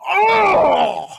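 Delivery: loud high yelp of pain; a burned victim's surprised shout; startled cry. Retold or imitated. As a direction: jolt out a sudden pained yelp. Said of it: "he goes".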